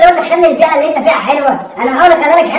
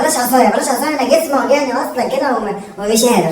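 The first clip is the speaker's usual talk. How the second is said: usual speaking voice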